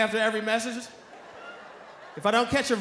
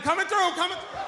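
higher, pushy polite voice; mimicking cousin; 7 semitones higher than usual. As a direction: squeeze through the crowd mockingly. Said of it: "I was right behind him".

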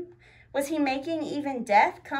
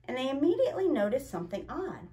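usual speaking voice

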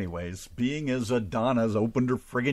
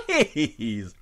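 usual speaking voice

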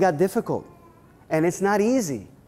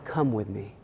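reassuring elder; 7 semitones lower, 6 dB quieter than usual. Retold or imitated. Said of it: "He said".

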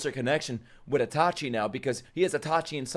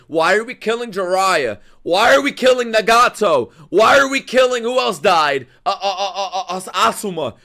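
loud, raised-pitch angry questioning; mad rant; 7 semitones higher, 7 dB louder than usual. Real, not acted. Viewer objecting to who gets killed, mad rant. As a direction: hammer each question in outrage. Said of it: "I'm so fucking mad".

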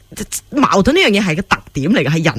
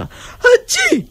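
a sudden high burst of a fake sneeze, achoo; high hammed-up achoo; mock sneeze. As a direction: act out someone sneezing without a mask. Imitating a sneeze in the lift.

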